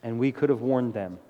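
earnest, measured prayer voice. Acted. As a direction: pray over the congregation. Leads closing prayer.

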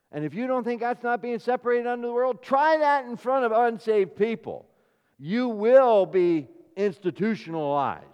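loud, high, forceful sermon delivery; impassioned preaching. Real, not acted. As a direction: drive the point home to the congregation.

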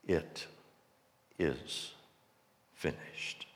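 very soft and low, word by word; dying words. A narrator's reading. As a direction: let each word fade to a last breath. Jesus's last words on the cross.

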